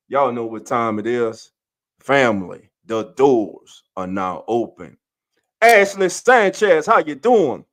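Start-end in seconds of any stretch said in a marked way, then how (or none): 5.6-7.7 high, loud welcome shout; hype greeting. Real, not acted. Host greets a viewer joining the show, hyped shout. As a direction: call the guest out like a hype host.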